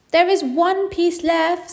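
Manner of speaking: put-on high, slow, helpful little girl; eager to help; helpful child. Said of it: "in a helpful way".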